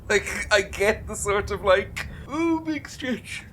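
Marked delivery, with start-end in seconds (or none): 2.3-3.4 very high, slow squeaky coo; cooing imitation. Imitating a reaction to a stretch, high slow coo.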